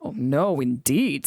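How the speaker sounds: solemn and weighty, lightly voiced; grave. A narrator's reading. Fat Controller to Thomas, mildly grave. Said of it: "said the Fat Controller gravely".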